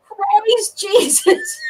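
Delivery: high, slow, drawn-out praise; secretly thrilled thanks to Jesus, high and slow; gleeful praise. Retold or imitated. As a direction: savour it like answered prayer. Inner joy at the theft.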